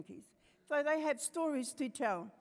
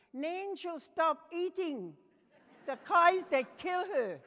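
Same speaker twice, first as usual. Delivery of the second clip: put-on higher, slow child announcing a rule; child's voice; 7 semitones higher, 6 dB louder, slower than usual. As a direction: spell out the rule slowly like a solemn kid. Quoting second treaty article.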